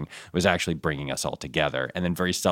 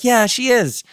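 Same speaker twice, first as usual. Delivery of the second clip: much higher, louder eager yes; emphatic agreement; 6 dB louder, slower than usual. Retold or imitated. Agreeing with Jamila about Tahani, high eager yes. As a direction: gush agreement. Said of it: "I was like".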